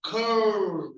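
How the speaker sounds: "Curl" drawn out, tongue curling back into the L; demonstrating tongue movement. Shown as a demonstration. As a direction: model "Curl" slowly and deliberately. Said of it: "notice how your tongue is moving".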